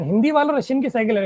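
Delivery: ordinary talk, nothing marked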